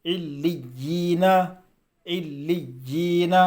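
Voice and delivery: علیین slow, doubled ل and ی pressed, long ee held; firm doubled-letter reading. Shown as a demonstration. Teacher showing students how to read it, firm drawn-out علیین. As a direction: chant علیین deliberately with a stretched ee. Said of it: "اس طریقے سے پڑھنا ہے".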